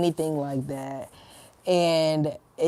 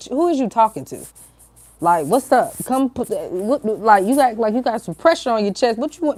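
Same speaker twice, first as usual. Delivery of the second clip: acted-out challenge, higher and punchy; confrontational old me; 4 semitones higher, 3 dB louder than usual. Her old self answering the rude lady, confrontational. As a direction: square up as if ready to fight. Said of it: "the old me was really about to pop up".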